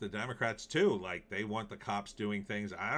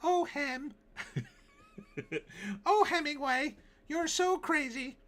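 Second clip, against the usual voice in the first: very high falsetto, giggling through the words; Shelley's voice. Acted. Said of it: "'cause I do Shelley's voice".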